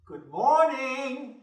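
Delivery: loud, drawn-out cheerful hello; slow loud greeting. Acted. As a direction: sing out the greeting slowly.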